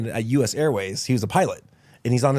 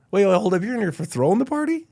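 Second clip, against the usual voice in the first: amazed guard; 9 semitones higher, 4 dB louder, faster than usual. Retold or imitated.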